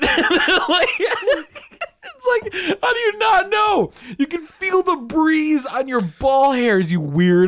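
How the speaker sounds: high, drawn-out comic disbelief; mock incredulity. Telling an oblivious guy off, mock incredulous. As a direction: scold them in a high, incredulous voice.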